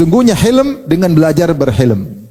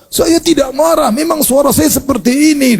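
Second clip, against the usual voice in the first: much higher, slow, strained denial; mock angry denial; 9 semitones higher, slower than usual. Preacher imitates a hothead denying he is angry. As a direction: insist you're not angry while clearly angry. Angry man denies being angry.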